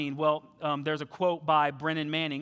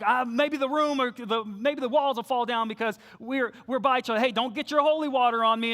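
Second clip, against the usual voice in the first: teasing joke; 6 semitones higher, 4 dB louder than usual. Retold or imitated. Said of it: "joking around, halfway at least".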